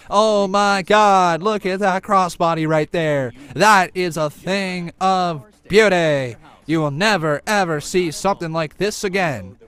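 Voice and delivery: loud, drawn-out exclamations, easing slightly toward the end; mock over-calm to loud commentary. Shown as a demonstration. Streamer showing critics his excited style instead, loud demo commentary. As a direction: burst into booming play-by-play hype. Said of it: "Would you rather that?".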